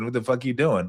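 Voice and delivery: usual speaking voice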